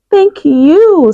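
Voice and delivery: thankful little animal voice; grateful giraffe. Acted.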